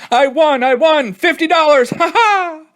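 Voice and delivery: high, loud whoops of victory; gleeful shouts. Acted. Billy alone in the barn, whooping over his win. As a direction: crow over winning fifty dollars.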